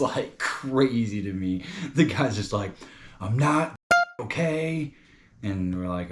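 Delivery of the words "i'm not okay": higher, louder mock-defiant guy; stubborn refusal. Acted stubborn guy refusing a mask.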